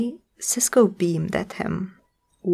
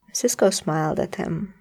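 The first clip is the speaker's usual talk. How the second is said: ordinary delivery, nothing marked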